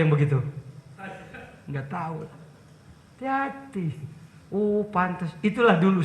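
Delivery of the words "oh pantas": ordinary talk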